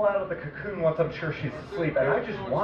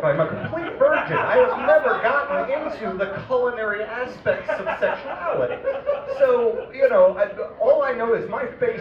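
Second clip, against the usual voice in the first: high, louder words with laughter in them; laughing through it; 7 semitones higher, 4 dB louder than usual. Real, not acted. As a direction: laugh as you confess. Laughing at his own innocence.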